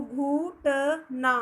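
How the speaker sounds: syllables stretched very slowly; teacher spelling a word out slowly; slow sounding out. Shown as a demonstration. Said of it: "घ को छोटा ऊ".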